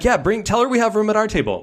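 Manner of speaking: high, eager quote; light excited yes. Retold or imitated. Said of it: "So I'm like".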